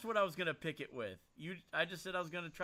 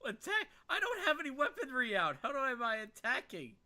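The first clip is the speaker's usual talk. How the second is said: incredulous protest; 8 semitones higher than usual. Real, not acted. Player protesting in disbelief.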